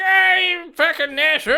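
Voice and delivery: high, loud furious cursing; angry old man yell. Acted. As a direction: snarl it out like a cranky old man. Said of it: "And he's like".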